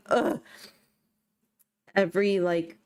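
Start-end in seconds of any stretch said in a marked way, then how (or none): 0.1-0.4 yawning through the word. Real, not acted. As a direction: let a yawn take it. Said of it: "Sorry, I'm yawning".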